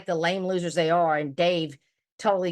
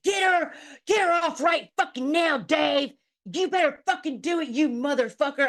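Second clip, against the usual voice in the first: high, fast imitating a raging man; playing John's rage for laughs; mock angry yell; 7 semitones higher than usual. Imitating John Camillo raging at Dave. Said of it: "he was like".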